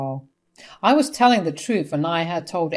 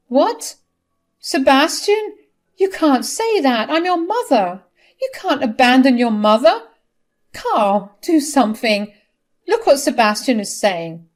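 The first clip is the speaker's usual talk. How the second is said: high, loud mother in dismay; aghast and pleading, pitched up high; shocked disbelief; 7 semitones higher, 7 dB louder than usual. A narrator's reading. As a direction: protest in a high, loud panicked voice. Mother shocked at son disowning her.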